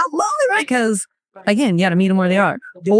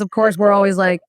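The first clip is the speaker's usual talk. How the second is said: ordinary talk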